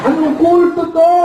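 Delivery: high, slow alarmed question; mock alarm. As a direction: ask in disbelief about a cult. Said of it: "Sabi ko".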